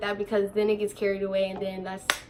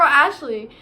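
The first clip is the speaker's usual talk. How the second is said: ordinary talk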